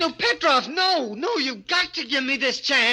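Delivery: desperate begging. Acted. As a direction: beg as the chance slips away. Begs Petrov against refusal.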